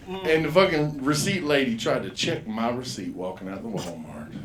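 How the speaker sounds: ordinary talk, nothing marked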